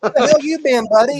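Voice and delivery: squeaky high mock yell. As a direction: pitch way up, teasing. Mimicking the live-mic line.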